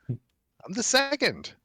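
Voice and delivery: laughing. Real, not acted. Laughing, high and slow.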